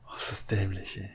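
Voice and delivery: hushed amused whisper. Real, not acted. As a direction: whisper it with a grin.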